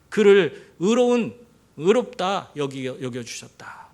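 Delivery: slow, weighty 의롭다, fading at the end; slow emphasis. Real, not acted. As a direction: spell it out slowly to the congregation.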